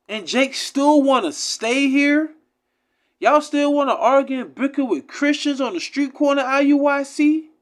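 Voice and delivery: fed-up outburst at the audience; getting upset. Real, not acted. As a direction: let the frustration boil over, pitched high and loud. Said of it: "I'm getting upset now".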